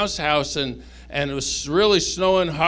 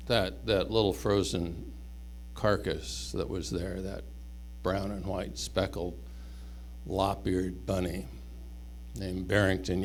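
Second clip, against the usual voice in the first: hushed sad narration; 8 semitones lower, 9 dB quieter than usual. A narrator's reading. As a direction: let the voice drop low and soft for the loss. Sad ending, dead bunny.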